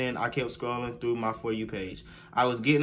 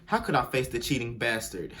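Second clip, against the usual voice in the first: ordinary talk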